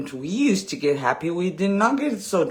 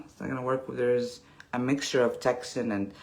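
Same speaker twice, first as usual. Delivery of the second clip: ordinary talk, nothing marked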